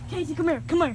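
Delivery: normal talking voice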